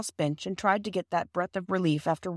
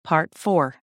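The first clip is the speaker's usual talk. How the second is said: ordinary delivery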